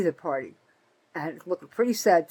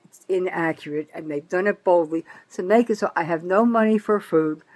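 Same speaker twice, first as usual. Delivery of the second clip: ordinary delivery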